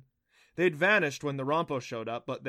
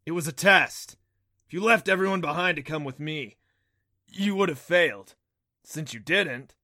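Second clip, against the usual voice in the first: loud, raised, resounding god voice; thunder god proclaiming; 4 semitones higher, 8 dB louder than usual. A narrator's reading. Thunder god praises Adam's faith and loyalty, proclaiming. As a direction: proclaim it grandly, with full force.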